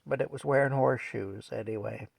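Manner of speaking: ordinary delivery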